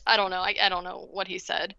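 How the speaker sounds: ordinary delivery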